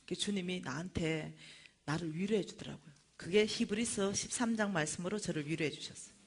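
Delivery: ordinary talk, nothing marked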